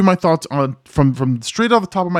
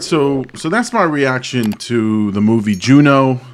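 ordinary talk

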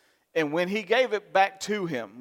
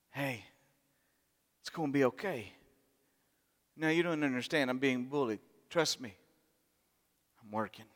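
soft reassuring voice; 2 semitones lower, 5 dB quieter than usual. Acted. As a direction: soothe a frightened man gently. God voiced speaking comfort.

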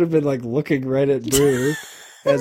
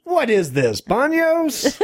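mock outraged catchphrase; 9 semitones higher, much slower than usual. Mocking a movie catchphrase.

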